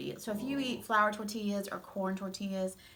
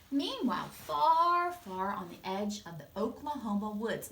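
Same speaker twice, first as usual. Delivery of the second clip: storytelling; 3 dB louder than usual. A narrator's reading. Storyteller opening new scene.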